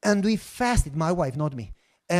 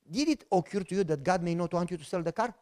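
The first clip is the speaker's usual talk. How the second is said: softer, patient wife voice; gentle wife question; 3 semitones lower, 7 dB quieter than usual. Retold or imitated. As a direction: ask him quietly.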